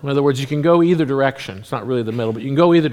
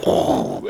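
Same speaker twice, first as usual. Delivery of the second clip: voiceless whooshing "whoa"; imitated rushing whoa. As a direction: mimic kids whooshing past. Imitates kids rushing for the light.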